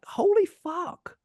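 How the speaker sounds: high, drawn-out awe; slow, high-pitched reenacted wonder; amazed exclamation. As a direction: relive the amazement, stretching it out high. Acting out amazement at drone view.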